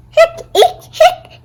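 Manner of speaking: high loud hiccups mixed with a squeal. Acted. Boy dodging a good luck kiss, squealing hiccups.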